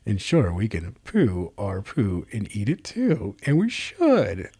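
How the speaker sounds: mocking a self-pleased writer, slowed; smug author. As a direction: ham up a smug writer savoring his own line. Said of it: "Did he get a little chuckle with himself".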